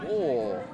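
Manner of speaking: "oh" rounded, falling low in pitch; Russian O. Shown as a demonstration. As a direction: drop "oh" into a deep Russian O. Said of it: "You have to say".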